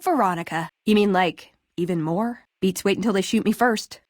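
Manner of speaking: normal talking voice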